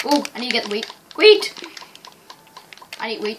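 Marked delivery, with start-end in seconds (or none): 1.2-1.5 a sudden loud, high yelp of the word; sudden shout. Real, not acted. Kid remembering the wheat, blurts a loud shout. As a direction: blurt it out as it hits you.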